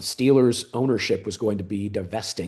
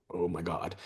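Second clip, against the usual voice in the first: low, much softer gasp of words; quiet shock; 4 semitones lower, 12 dB quieter than usual. Retold or imitated. Hearing the amount from his friend, quietly stunned. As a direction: murmur it, taken aback. Reacting to the price.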